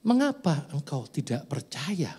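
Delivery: slow, hushed whisper; whispered words of God. Acted. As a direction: whisper God's question gently and slowly. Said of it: "kata Tuhan". (bisikan pelan suara Tuhan)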